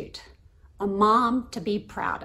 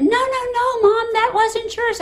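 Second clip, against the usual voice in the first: high, louder imitation of a protesting daughter; daughter shouting; 13 semitones higher, 5 dB louder than usual. Daughter trying to stop her mom at the pool, shouting. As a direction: call out in a much higher voice, urgently stopping mom. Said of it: "she's still shouting".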